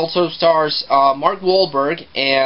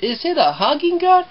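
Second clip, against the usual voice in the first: much higher, sweet cartoonish bear voice; innocent teddy bear; 12 semitones higher than usual. Teddy bear asking the parents, innocent high cartoon voice. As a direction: play a naive cuddly toy asking innocently. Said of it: "the bear says".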